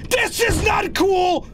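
high, drawn-out panicked shout; slow, high-pitched yelp of protest. Real, not acted. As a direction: protest in a high, stretched wail. Scared, protesting at the game.

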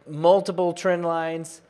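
usual speaking voice

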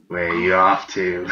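slow, sneering goblin with a laugh. Acted. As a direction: drawl it out like a giggling goblin. Goblin taunts the traveler.

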